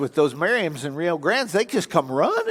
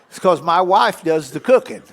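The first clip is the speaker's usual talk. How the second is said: ordinary talk, nothing marked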